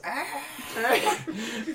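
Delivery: high-pitched monster screech; imitated Godzilla screech. Co-host acting out Godzilla coming up head first, high screech. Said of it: "head up like this".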